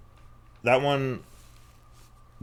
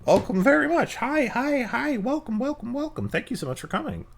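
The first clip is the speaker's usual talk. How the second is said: squeaky greeter voice; 9 semitones higher, 5 dB quieter, faster than usual. Acted. Greeting raiders in a voice.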